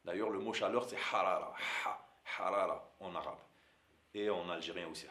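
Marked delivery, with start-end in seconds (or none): 0.9-3.4 harara with a strong breathy throat h; throaty h in harara. Shown as a demonstration. Teacher demonstrating the Arabic h in harara, from the throat. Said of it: "beaucoup plus au niveau de la gorge".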